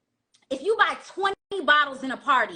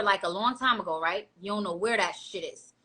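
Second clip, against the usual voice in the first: normal talking voice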